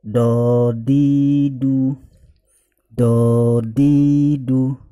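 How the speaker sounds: slow, drawn-out syllables do-di-du; dodidu, each syllable stretched and clear; over enunciating. Shown as a demonstration.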